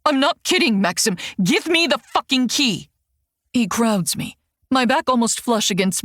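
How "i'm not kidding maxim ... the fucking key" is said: high, furious demand; angry demand. Acted. Wife demanding her room key from her husband, furious. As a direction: bark the demand pitched up.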